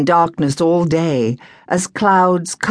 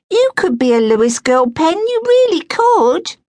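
eager housewife; 13 semitones higher, 4 dB louder than usual. Acted. Missus Tyson urging.

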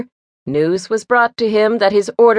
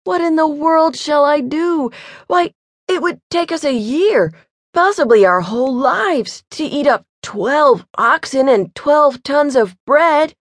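despairing simpleton; 5 semitones higher than usual. A narrator's reading. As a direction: wring out the impossible task.

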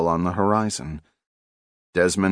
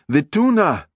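raised, higher cry of alarm; 9 semitones higher, 5 dB louder than usual. Acted. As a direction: cry out in dismay at the dead fish. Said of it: "the nephew cried".

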